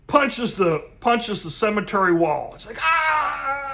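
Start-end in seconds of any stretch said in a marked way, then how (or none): 2.8-3.7 sharp high yelp of pain from a fist hitting stone; pained cry. Acted. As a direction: yelp high as if you just punched a wall. Punches the wall, cries out.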